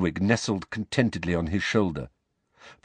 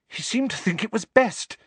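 breathless; 13 semitones higher than usual. Acted. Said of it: "rather breathlessly".

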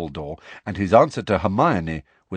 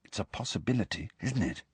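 dropped voice, much softer; hushed; 9 dB quieter than usual. Acted. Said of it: "dropping his voice".

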